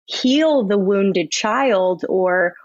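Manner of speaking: ordinary talk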